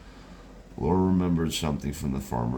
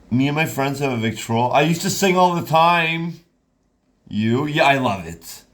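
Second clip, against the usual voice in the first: excited; 11 semitones higher, 8 dB louder than usual. Acted. Woman brightens talking about singing.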